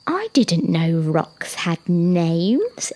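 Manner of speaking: very slow, drawn-out astonishment; shocked. Acted. Young giraffe hearing rocks have names, drawn-out shock. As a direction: stretch it out in disbelief.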